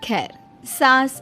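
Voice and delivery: plain reading voice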